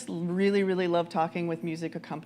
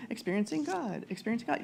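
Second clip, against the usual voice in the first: trailing, softer echo of experiencing God; fading refrain; 2 semitones higher, 6 dB quieter than usual. Shown as a demonstration. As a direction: repeat it softer each time.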